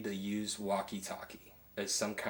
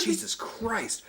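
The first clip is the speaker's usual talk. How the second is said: higher, slow mock-shocked exclamation; retold shocked oath; 12 semitones higher, much slower than usual. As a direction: replay the customer's shock at the price. Retelling customer's shocked exclamation.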